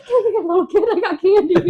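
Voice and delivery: high, loud mock luring voice; hammed-up high coaxing through laughter; candy-offering lurer. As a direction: jokingly tempt a child with a treat. Joking as stranger luring a kid.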